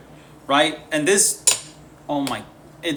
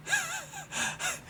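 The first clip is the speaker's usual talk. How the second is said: high giggle; 10 dB quieter than usual. Real, not acted. Giddy over new setup.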